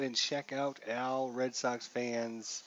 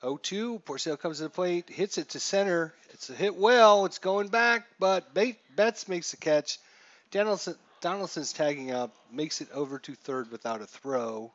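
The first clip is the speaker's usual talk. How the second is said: excited play-by-play; 4 semitones higher, 7 dB louder than usual. Acted. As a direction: build the call as it carries back. Play-by-play of the fly ball.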